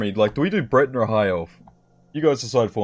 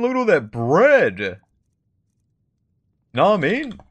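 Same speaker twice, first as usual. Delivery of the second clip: excited; 5 semitones higher, 3 dB louder than usual. Real, not acted. Sees pile of bread.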